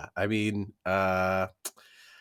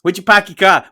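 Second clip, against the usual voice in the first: put-on louder, higher Boston accent; 7 semitones higher, 10 dB louder than usual. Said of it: "Boston accent".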